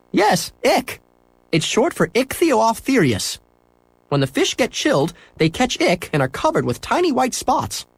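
high, friendly shopkeeper; cheerful shopkeeper agreeing. A narrator's reading. Shopkeeper to boy in pet store, high and cheerful.

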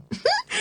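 put-on very high, short girlish squeal of laughing; squeal of laughter. A narrator's reading. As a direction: let out a quick delighted squeal. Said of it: "Anne gave a squeal of laughter".

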